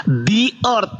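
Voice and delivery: The earth with the said as di before the vowel; correct vowel reading. Shown as a demonstration.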